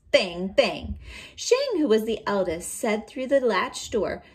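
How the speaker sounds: loud, lively read-aloud voice; storybook reading. A narrator's reading. Teacher reading a folktale aloud to her students, loud and lively. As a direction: read it big for the class.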